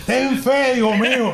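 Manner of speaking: high, mock-tender cry to a son; lightly playing the proud mother. Retelling the airport mother's farewell shout. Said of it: "Y la mamá".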